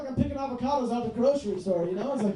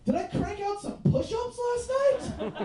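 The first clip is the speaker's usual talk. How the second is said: much higher, slow, laughing disbelief; mock hungover amazement; 10 semitones higher, slower than usual. Hungover comic waking up sore, mock amazed and laughing. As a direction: marvel at your own sore muscles. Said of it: "I was like".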